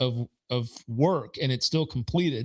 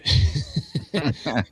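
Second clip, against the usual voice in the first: laugh. Real, not acted. Laughing at the insult.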